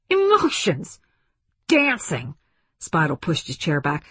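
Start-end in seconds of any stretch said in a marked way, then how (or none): 0.1-2.3 high-pitched incredulous scorn; fed-up, mocking outburst; scornful exclamations. A narrator's reading. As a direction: throw each word out in exasperation. Fed-up general ridicules her argument.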